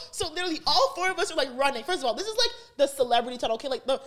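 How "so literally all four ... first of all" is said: ordinary delivery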